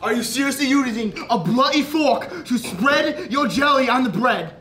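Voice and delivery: high, loud, slow outraged scolding; furious chef. Acted. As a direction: tear into the cook. Chef scolds fork use.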